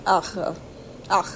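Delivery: ach with a gargling throaty rasp; gargled German R. Shown as a demonstration. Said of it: "a bit like gargling".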